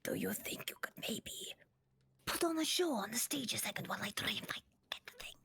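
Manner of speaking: fast hushed whisper; conspiratorial whisper. Acted. Ava whispers a secret plan to Corgi.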